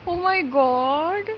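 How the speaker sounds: a very slow, drawn-out oh my God; recalling the shock, long and slow; shocked gasp. Retold or imitated. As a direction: stretch it out, stunned. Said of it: "I was so shocked".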